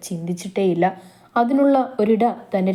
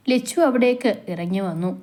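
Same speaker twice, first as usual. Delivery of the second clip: usual speaking voice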